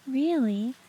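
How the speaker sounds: surprised gasp. Real, not acted. Gasping reaction to chat praise.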